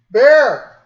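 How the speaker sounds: high, booming one-word summons; loud call. Real, not acted. Host calling Bear down to join the show, loud yell. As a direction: yell to someone in another room. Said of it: "Cover your ears".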